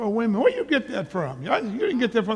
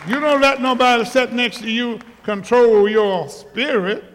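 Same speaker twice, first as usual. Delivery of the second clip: full-force preaching; 3 semitones higher, 5 dB louder than usual. Acted. Pastor urging the church to praise, full-force preaching. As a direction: build it up and push to the rafters.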